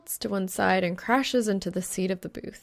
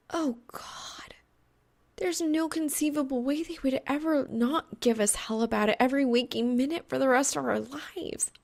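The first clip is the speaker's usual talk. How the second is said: higher, whispered despair rising into a rant; a pitched-up groan of doom; disgusted dread; 7 semitones higher than usual. Acted. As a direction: moan it out in horror, then rush on.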